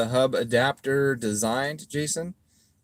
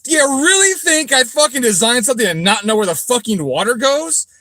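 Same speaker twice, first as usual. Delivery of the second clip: much higher, much louder angry yelling; furious rant; 8 dB louder than usual. Real, not acted. Shouting at online critics who doubt his design. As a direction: blast the critics at full volume.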